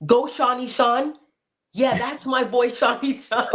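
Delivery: hammed-up sideline dad yell; imitated bleacher yelling. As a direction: call it out from the back of the bleachers. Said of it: "you can hear him at the back of the bleachers yelling".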